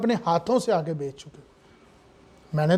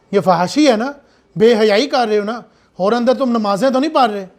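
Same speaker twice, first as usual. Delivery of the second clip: normal talking voice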